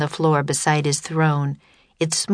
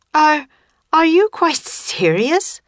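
raised high, a bit louder; high boyish voice; 12 semitones higher, 4 dB louder than usual. A narrator's reading. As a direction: lift the voice up high. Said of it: "said Teddy".

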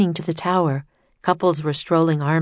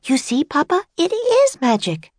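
put-on high-pitched excited little girl voice; young girl; 11 semitones higher than usual. A narrator's reading. Girl proudly showing Papa the exhibit, high young girl's voice. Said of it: "a young girl's voice".

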